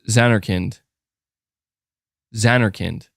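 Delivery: Zanarkand as ZAN-er-kind, short i at the end; tentative "Zanarkand", said ZAN-er-kind; practicing pronunciation. Shown as a demonstration. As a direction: try Zanarkand with stress up front, ZAN-er-kind. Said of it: "am I saying it right".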